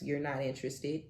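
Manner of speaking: ordinary talk, nothing marked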